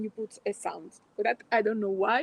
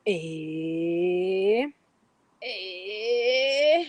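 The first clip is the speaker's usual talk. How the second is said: straining grunts; 4 dB louder, much slower than usual. Shown as a demonstration. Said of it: "we break the chains and we laugh".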